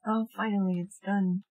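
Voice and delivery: usual speaking voice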